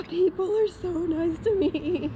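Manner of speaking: moved, crying laugh; choked up with gratitude; tearful. Real, not acted.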